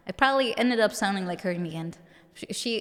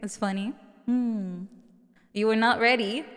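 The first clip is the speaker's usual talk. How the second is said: normal talking voice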